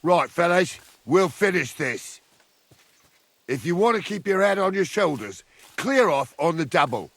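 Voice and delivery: barked threat. Acted. Warning a band of men to clear off, loud threat. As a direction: order them off with force.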